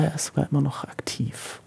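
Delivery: quick whisper under the breath; a half-secret remark, whispered quickly; whispered aside. Real, not acted. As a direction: drop to a fast confidential whisper. Aside about the singer still active.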